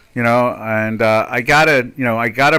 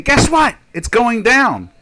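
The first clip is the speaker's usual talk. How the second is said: high, loud mock reveal; imitating an excited announcement; mock good-news announcement; 10 semitones higher, 5 dB louder, slower than usual. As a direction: sell it as great news, mockingly. Mimics them announcing a decrease.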